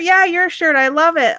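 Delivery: high-pitched, cheery reassurance; acted enthusiasm. Driver confirming the compliment to a stranger, acted high enthusiasm.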